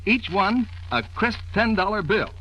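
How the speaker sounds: normal talking voice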